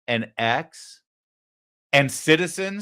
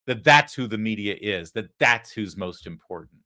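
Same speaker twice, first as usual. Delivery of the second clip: usual speaking voice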